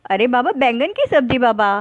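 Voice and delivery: ordinary delivery, nothing marked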